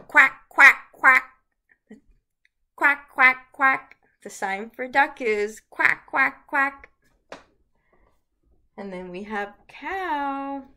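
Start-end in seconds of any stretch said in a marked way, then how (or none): 0.1-1.2 loud, high, punchy quacks; duck quack. Shown as a demonstration. Imitates duck for children. 2.8-4.0 loud, high, punchy quacks; duck quack. Shown as a demonstration. Imitates duck for children. 5.7-6.9 loud, high, punchy quacks; duck quack. Shown as a demonstration. Duck sound with the sign.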